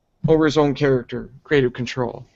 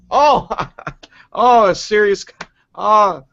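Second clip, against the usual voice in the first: high, loud, laughing scoff at a lame joke; mocking laugh; 5 semitones higher, 5 dB louder than usual. Real, not acted. Podcast host scoffing at Cena's lame joke, laughing and loud.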